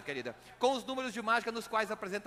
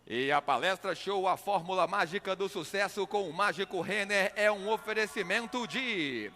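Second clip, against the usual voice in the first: ordinary talk, nothing marked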